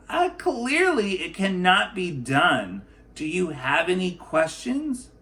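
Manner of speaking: an official waving the matter away; the clerk. Acted. Clerk voiced in picture book.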